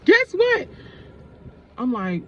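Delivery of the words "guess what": high, loud, eager guess what; bursting to share the news; coworker's excited news. Retold or imitated. Coworker teasing her big news, high and loud. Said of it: "She was like".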